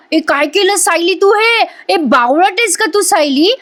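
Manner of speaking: high, shrill-sounding angry yell at Sayali; shouting angrily. A narrator's reading. Priya yells at Sayali over the stained dress, high and furious. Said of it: "Priya मोठ्याने ओरडते".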